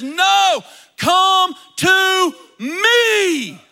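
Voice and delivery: high, very slow, loud cry; loud call. Retold or imitated. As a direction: proclaim it to the whole crowd, stretched out. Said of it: "steps in front of the whole thing and says".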